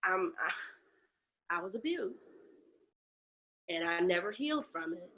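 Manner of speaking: barely able to say it; pained confession. Real, not acted. Confessing childhood abuse to Andrea, pained and soft.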